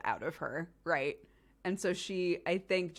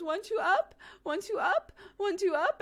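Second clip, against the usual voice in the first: put-on high, loud toddler chant through tears; mock-sobbing little girl pleading up; a whining toddler's high mock chant; imitating toddler; 13 semitones higher, 5 dB louder than usual. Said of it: "she's sobbing and has now changed tactics".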